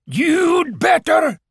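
very high, slow wailing voice; haunt him with long stretched vowels; ghostly. A narrator's reading. Fake uncle's ghost warning Owl off, ghostly. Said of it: "said the voice".

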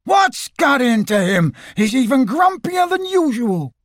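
high, bouncy, put-out voice; a cartoon tiger, offended; indignant Tigger.

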